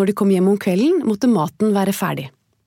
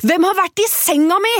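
high dwarf voice calling out; 10 semitones higher, 3 dB louder than usual. Acted. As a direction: call out in a high little dwarf voice. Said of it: "ropte han".

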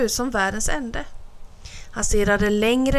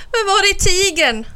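mother rabbit voice; 10 semitones higher, 5 dB louder than usual. A narrator's reading.

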